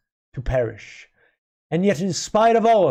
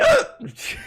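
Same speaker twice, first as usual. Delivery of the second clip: hiccup. Real, not acted. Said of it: "when I'm hiccuping".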